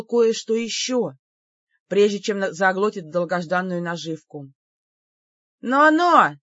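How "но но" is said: high, loud mock warning; warning "now now". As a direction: wag a finger with your voice. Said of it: "прищурилась я".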